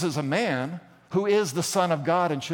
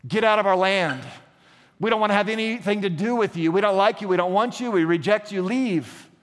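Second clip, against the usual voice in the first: raised, insistent mock rebuff; acted angry rejection; 4 semitones higher, 3 dB louder than usual. Townspeople order Jesus off their land, acted angry rejection. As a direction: drive him away in a heated retelling.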